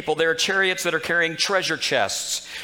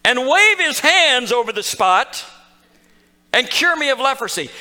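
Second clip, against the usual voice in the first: higher and louder, slowing as he rants; insulted; 5 semitones higher, 4 dB louder than usual. Retold or imitated. Preacher voicing Naaman's wounded pride, loud and indignant. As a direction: spell out the show he was owed, offended. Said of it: "He's insulted".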